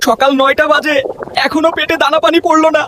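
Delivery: high, mildly anguished complaint; hungry wail. Acted. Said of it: "ক্ষুধার যন্ত্রণায় আর্তনাদ করে সাজু বলল".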